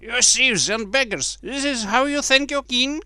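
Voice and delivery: high-pitched indignant outburst; angry cry. A narrator's reading. Little king rebukes the laughing beggars, angry high cry. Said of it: "the angry king cried out".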